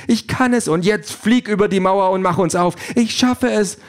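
high-pitched rush of triumph; high, thrilled character voice; excited cry. A narrator's reading. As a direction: call out high and elated. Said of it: "ruft sie".

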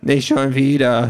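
high, loud, drawn-out complaint; grumbling. A narrator's reading. Quality Pad moans about yet another repeat, grumbling. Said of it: "hört man das Quality Pad noch meckern".

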